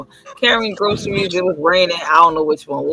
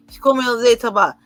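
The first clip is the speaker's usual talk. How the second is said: ordinary delivery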